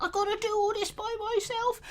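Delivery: high, soft, whiny mock self-pity; hammed-up weepy little hero; mock tearful Frodo. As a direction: imitate someone feeling sorry for himself, thin and quiet. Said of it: "he's got tears in his eyes".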